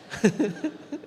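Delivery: laugh. Real, not acted. Preacher laughs at own joke.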